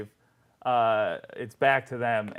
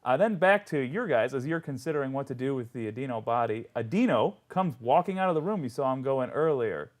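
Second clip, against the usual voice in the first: usual speaking voice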